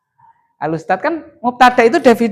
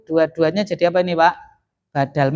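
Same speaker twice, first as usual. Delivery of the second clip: usual speaking voice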